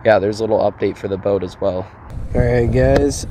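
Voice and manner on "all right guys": rough from a cold; husky, voice half gone; hoarse voice. Real, not acted. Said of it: "Probably can't hear me super well".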